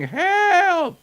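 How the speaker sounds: high, loud cries of "Help!"; shouting for help. A narrator's reading. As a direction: scream for help while running in panic. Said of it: "running through the village shouting".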